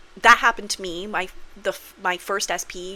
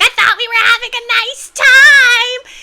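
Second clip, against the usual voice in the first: shrill, much higher and louder wail; hysterical outburst; 14 dB louder than usual. Retold or imitated. Girlfriend standing up in the restaurant, hysterical shriek. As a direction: explode in a public meltdown.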